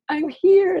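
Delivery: high, loud burst of excitement; thrilled inner voice. Retold or imitated. Telling a friend about New York, bursting with excitement. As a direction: say it like you just arrived at your dream.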